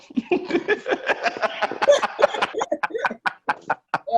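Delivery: high breathy burst of laughter; cracking up at his own joke; laughing. Real, not acted. Cohost laughs at his Morse code answer.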